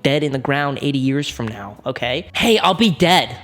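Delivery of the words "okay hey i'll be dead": normal talking voice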